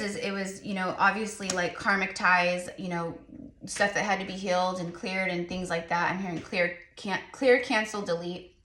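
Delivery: usual speaking voice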